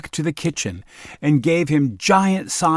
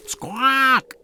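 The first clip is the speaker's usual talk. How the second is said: hen squawk; 13 semitones higher than usual. Acted.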